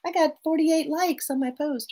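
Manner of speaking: high, giddy imagined social media user; mock delight. Therapist acting out a happy hormone moment, mock delight. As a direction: imitate someone thrilled, pitched well up. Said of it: "a little bit of happy hormone".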